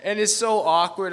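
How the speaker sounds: loud, high laughter through the words; laughing. Real, not acted. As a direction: laugh out loud while telling it.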